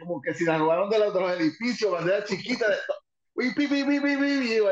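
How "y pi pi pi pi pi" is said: high, quick pi pi pi noise; imitated beeping noise. Wrestler recalling the arena, imitating the noise high. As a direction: chirp the little noise out high.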